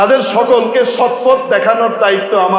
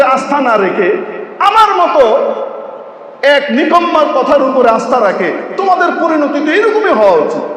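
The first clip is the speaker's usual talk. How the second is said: mocking scorn; 6 semitones higher than usual. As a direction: jeer at the fools who trusted him. Satan mocks the damned. (শয়তান জাহান্নামীদের বিদ্রূপ করছে, উঁচু গলায় ঠাট্টা)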